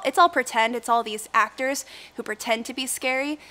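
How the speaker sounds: ordinary talk, nothing marked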